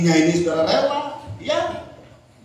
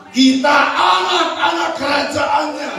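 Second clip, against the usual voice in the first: much higher and louder, triumphant; shouted proclamation; 14 semitones higher, 5 dB louder than usual. Real, not acted. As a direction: declare victory to the church. (seruan kemenangan yang lantang)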